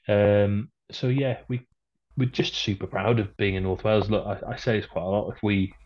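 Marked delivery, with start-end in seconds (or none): none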